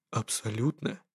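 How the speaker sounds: softly, fully sure; quiet and certain. A narrator's reading. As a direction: drop the voice, calm certainty. The professor's reply. (тихо и уверенно)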